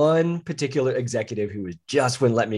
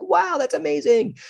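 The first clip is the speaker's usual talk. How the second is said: amazed wow; 14 semitones higher than usual. Retold or imitated. Reacting to friend's Paris news.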